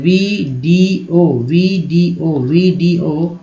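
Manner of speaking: "Video" stretched very slowly, each sound held; drawn-out demo. Shown as a demonstration. Teacher modelling a word for students, very slowly drawn out. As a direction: draw out "video" slowly for the class. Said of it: "ये सभी लोग जानते हैं".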